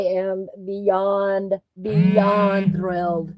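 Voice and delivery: drawn-out delight. Real, not acted. Gushing over Christmas gift tables.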